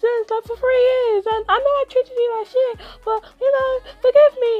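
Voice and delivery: high, pleading mock bully voice with a laugh; bully pleading with the guy he bullied, high mock whine; whiny begging. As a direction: beg for your life, hammed up and high. Bully begging the victim for mercy.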